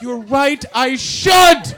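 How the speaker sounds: high, loud happy yell; sudden cheery agreement. Retold or imitated. Visitor told to calm down by Scotland, gleeful shout. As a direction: burst out agreeing with glee. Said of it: "And you're like".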